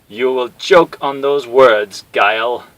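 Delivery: slow loud menacing villain; villain threat. Acted. Bison threatening Guile, slow menacing. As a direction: threaten through the screen.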